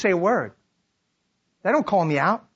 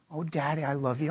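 soft, low, loving reply; gently voiced daughter comforting her dad; hushed affection; tender forgiveness; 3 semitones lower, 8 dB quieter than usual.

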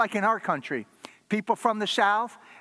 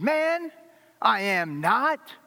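emphatic denial; 5 semitones higher, 3 dB louder, much slower than usual. Acted. Peter denies again.

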